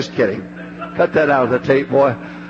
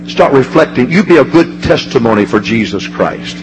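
low, loud, pounding preacher cadence; fervent preaching; 7 semitones lower, 4 dB louder than usual. Acted. Preacher telling a mother's loss, low and pounding. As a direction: drive the heartache home with heavy bursts.

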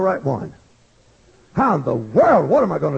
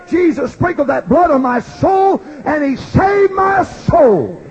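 raised, fervent preaching voice; full-force preaching; 7 semitones higher, 5 dB louder than usual. Acted. Preacher testifying to the congregation, fervent. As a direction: preach it out with fire.

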